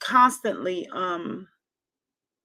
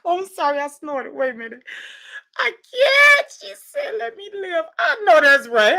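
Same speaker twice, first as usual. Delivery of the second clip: high, tearful laughing squeals; laughing so hard it sounds like crying; squealing through tears of laughter; 7 semitones higher, 4 dB louder than usual. Real, not acted.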